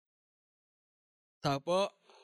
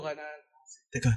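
usual speaking voice

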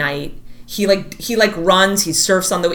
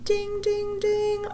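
high, sing-song ding like a game show bell; mock bell ding; 13 semitones higher, 8 dB quieter, much slower than usual. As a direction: chime it like a prize bell.

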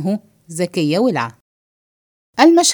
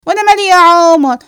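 loud, high, indignant brush-off; defiant retort; 7 semitones higher, 8 dB louder than usual. Acted. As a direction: push back loudly with indignation. Defiant answer in acted quarrel. (تحدي بصوت عالي ومستنكر)

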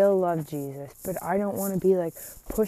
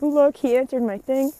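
excited; 6 semitones higher, 5 dB louder than usual. Retold or imitated. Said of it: "And it was just exciting".

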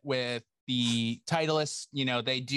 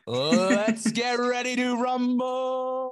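high, slow, drawn-out announcer call; ring announcer; 11 semitones higher, 5 dB louder, much slower than usual. Pretend. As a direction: bellow it like a fight announcer. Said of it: "my own personal hype man".

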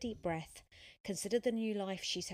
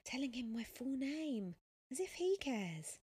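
higher-pitched inner scolding; self-mocking thought; 7 semitones higher than usual. A narrator's reading. Sophia thinking she overshared with the cyclist, self-mocking. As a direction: berate yourself in a higher voice. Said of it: "she thought".

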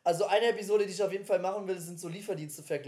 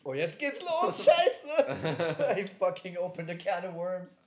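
laughing; slower than usual. Real, not acted. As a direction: laugh out the alarm. Laughing alarm at the topic.

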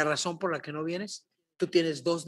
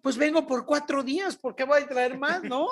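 surprised question; 8 semitones higher, 4 dB louder than usual. Retold or imitated. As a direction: mimic her amazement at so little luggage. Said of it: "Y entonces ella me dice". (sorpresa imitada, aguda y lenta)

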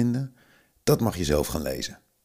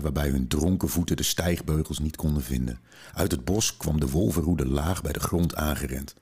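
plain reading voice

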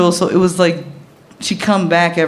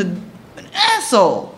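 ordinary talk, nothing marked

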